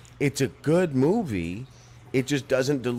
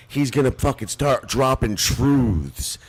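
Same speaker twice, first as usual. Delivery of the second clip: ordinary talk, nothing marked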